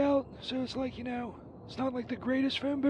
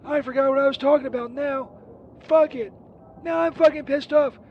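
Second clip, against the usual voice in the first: angry outburst; 7 dB louder than usual. Acted. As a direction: blow up and yell the curses. Furious after being pissed on.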